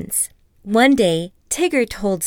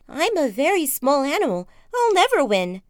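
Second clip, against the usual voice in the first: higher, small fretful Piglet; worried Piglet; 6 semitones higher than usual. Acted. Piglet fearing he'll lose, high fretful. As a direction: whimper like a tiny pig. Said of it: "Piglet cried".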